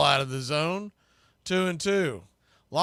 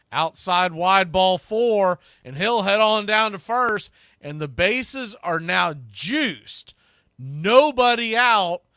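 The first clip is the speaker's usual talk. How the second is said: building excitement; 5 semitones higher, 5 dB louder than usual. Real, not acted. Walk loads the bases.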